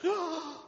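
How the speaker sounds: put-on high startled cry like a little girl; high gasping "Ah!" of alarm; imitated child's gasp. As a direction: mimic a shocked four-year-old.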